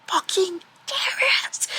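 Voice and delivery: strained whispered outburst; exasperated whisper-scream. Real, not acted. Reader fed up with a slow-burn couple, exasperated whisper-scream. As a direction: scream it under your breath in frustration.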